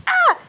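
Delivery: a quick, very high-pitched "ah"; short high yelp. Real, not acted. Vlogger regrets waking late, short high yelp. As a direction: let out a brief squeak of frustration.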